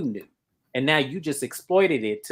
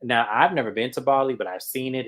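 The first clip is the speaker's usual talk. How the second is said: ordinary delivery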